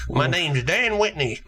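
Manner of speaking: Dan Whitney. Acted. Podcaster mimicking a stand-up to co-hosts, funny Southern voice. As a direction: introduce yourself like a Southern stand-up comic. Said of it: "a funny Southern voice".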